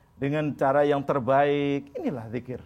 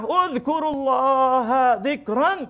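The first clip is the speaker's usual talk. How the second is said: slow Quranic recitation; 9 semitones higher, 5 dB louder, slower than usual. Shown as a demonstration. Reciting verse with congregation.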